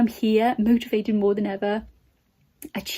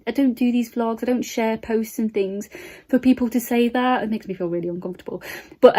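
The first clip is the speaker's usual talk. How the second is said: ordinary talk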